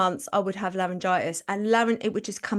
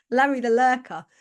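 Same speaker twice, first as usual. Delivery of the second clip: ordinary talk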